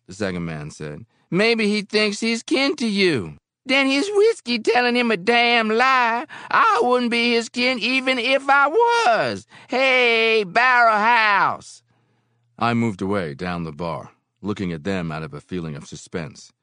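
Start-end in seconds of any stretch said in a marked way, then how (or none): 1.3-11.8 high, loud, drawn-out taunt; drawling, high-pitched insult, ending in a long call; scornful bar drinker. A narrator's reading. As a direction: heckle loudly and slowly across the bar.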